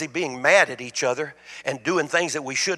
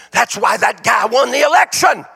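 loud, much higher, slow rallying line; rousing preaching; 7 semitones higher, 5 dB louder than usual. Acted. Preacher rousing the congregation, loud and high. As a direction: lift the crowd up. Rousing climax about the election.

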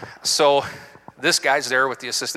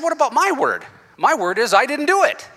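high and louder, pushing back hard; protesting to the officer in a high, louder indignant voice; 10 semitones higher, 5 dB louder than usual. Retold or imitated. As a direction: insist on your own word.

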